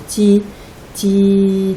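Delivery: "ti" repeated slowly as a lone syllable. Shown as a demonstration. Teacher hunting words that start like Timéo, repeating. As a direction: chant "ti, ti" while searching for words. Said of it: "On peut répéter la première syllabe".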